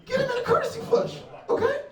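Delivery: squealed while laughing. Acted. Comic punchline squealed high.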